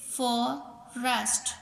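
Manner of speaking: फॉरेस्ट as two separate beats, फॉ, then रेस्ट; teacher sounding out फॉ—रेस्ट; split into syllables. Shown as a demonstration. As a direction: say फॉरेस्ट slowly, pausing before रेस्ट. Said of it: "टू vowel sound देते हों".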